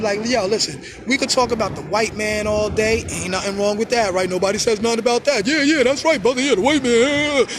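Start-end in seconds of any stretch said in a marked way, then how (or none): 5.5-7.5 higher-pitched mock cheering-on; mock chorus of approval; eager agreement. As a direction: egg the speaker on, hammed-up. Acting out people eagerly agreeing.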